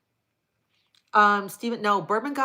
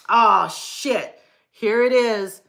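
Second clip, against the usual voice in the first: surprised; 3 semitones higher, 9 dB louder, slower than usual. Real, not acted. Sudden find while reading chat.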